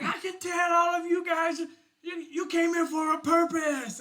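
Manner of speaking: high, loud hype-man shout; goblin emcee. Acted. Goblin emcee shouting to the concert crowd, high and loud. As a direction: pump up the crowd like a rock emcee.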